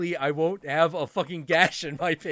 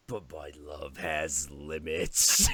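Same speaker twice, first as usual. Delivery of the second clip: much lower, slow menace; ominous cat; 12 semitones lower, slower than usual. Retold or imitated. Cat warning its would-be friend, low menace. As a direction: drop into a dark threat. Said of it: "he's like".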